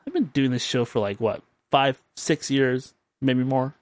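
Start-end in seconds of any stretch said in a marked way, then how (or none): none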